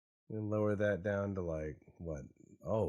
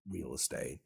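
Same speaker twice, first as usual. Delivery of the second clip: normal talking voice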